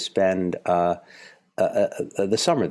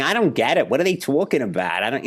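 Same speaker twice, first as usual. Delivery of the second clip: put-on Philadelphia accent; 9 semitones higher than usual. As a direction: do a broad Philadelphia cousin. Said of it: "talked like".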